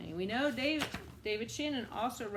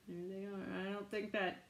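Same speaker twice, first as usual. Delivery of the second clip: quiet aside; 3 semitones lower, 8 dB quieter than usual. Real, not acted. Hushed aside to the children.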